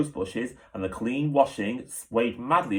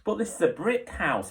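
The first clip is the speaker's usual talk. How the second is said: high, insistent correction; objecting; 9 semitones higher than usual. A narrator's reading. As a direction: set him straight. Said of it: "Polly objected".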